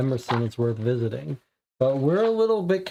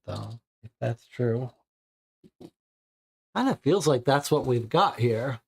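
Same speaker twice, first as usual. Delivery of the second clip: ordinary talk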